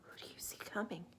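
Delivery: usual speaking voice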